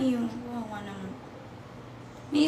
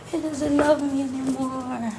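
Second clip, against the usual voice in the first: ordinary delivery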